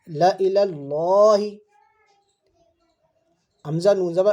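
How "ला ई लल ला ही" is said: ला said short and unstretched, slowly. Shown as a demonstration. Teacher shows students ला said short, not stretched. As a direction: keep ला short, no drawn-out vowel. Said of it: "ला भी नहीं खींचेगा".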